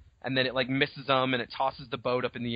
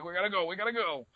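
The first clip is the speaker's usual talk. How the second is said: hurried urging; 5 semitones higher, 3 dB quieter, faster than usual. Acted. Grant rowing faster from the T-Rex, hurried urging. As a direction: rattle it off urgently under your breath. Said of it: "rowing faster".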